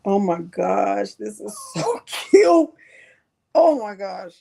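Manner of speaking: choked-up delight; tearful joy. Real, not acted. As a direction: overcome with happy tears. Moved by cute gift, crying.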